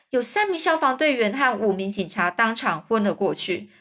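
ordinary delivery, nothing marked

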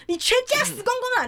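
high, piercing mock shriek of a madwoman; crazy woman shriek. Homeless woman turning to curse the speaker, shrieked high. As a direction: shriek it high like an unhinged stranger. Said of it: "转身往我这边走来说".

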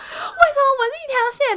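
high-pitched mock sob, laughing through it; mock wailing. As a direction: replay the crying as a joke, laughing. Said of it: "我就开始爆哭". (笑着模仿当时爆哭地喊)

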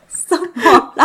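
asking through laughter; laughing. Real, not acted. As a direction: laugh out the question. Laughing at a sudden burp.

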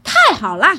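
loud, happy exclamation; delighted. A narrator's reading. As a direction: burst out pleased at the offer. Son delighted at marriage offer. (高兴地大声说)

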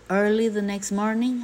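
usual speaking voice

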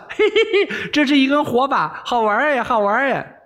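put-on high giggling girl's voice, delighted; gleeful laughing. Princess laughs while she shakes the burning pigtail, high giggle. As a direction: laugh like a naughty girl at her prank. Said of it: "哈哈笑".

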